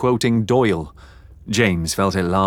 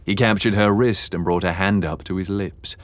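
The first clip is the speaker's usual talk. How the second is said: ordinary delivery, nothing marked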